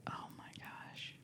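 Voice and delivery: slow shocked whisper; frantic whisper. Acted. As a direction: gasp it out in a whisper. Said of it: "Why are you whispering?".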